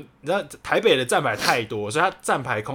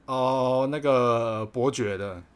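ordinary talk

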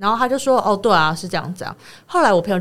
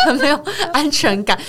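higher, laughing through a mock complaint; can't keep the retold line straight; laughing retelling; 6 semitones higher than usual. Host retelling the boyfriend's complaint to co-host, laughing.

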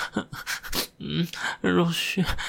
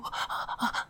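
normal talking voice

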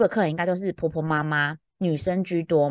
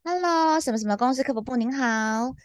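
professional customer service; 4 semitones higher than usual. Retold or imitated. Woken night-shift agent answering a customer, professional phone voice. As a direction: act wide awake and professional on the phone. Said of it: "想让人家以为我还是很专业的".

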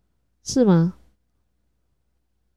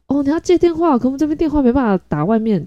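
higher, polite service voice; counter clerk; 8 semitones higher than usual. Retold or imitated. Clerk telling the mother the phone can't call out, in a higher polite voice. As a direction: chirp it like a shop assistant.

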